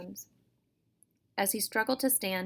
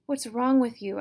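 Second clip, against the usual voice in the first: louder, higher exasperated demand; 4 semitones higher, 6 dB louder than usual. Acted. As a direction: shake her and demand an answer. Brother struggles with sister.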